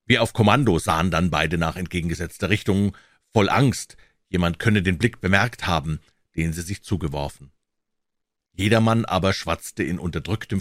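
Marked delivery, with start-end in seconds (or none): none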